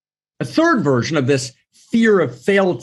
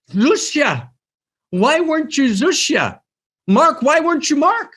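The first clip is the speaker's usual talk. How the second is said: the cosmic question asked ever higher and more urgently; heavenly question, rising; 13 semitones higher than usual. Retold or imitated. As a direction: press the question, climbing higher each time. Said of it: "And the question's gonna be".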